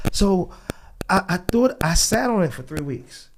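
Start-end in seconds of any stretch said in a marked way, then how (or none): none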